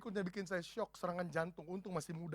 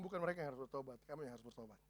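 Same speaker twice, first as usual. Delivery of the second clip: much softer, low gentle rebuke; soft, hushed "kamu yang harus bertobat"; quiet voice of God; 6 semitones lower, 11 dB quieter, faster than usual. Retold or imitated. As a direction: let God say it quietly and close. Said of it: "Tuhan bilang".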